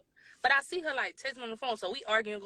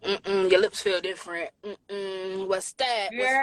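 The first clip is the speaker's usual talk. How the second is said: suspicious questioning; 4 semitones lower, 6 dB louder, slower than usual. Retold or imitated.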